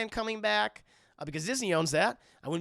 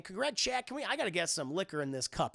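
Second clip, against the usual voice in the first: ordinary talk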